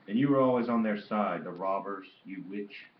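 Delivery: put-on embittered old man's accusation, understated after the first burst; bitter cursing. A narrator's reading. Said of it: "bitterly complaining, cursing his children".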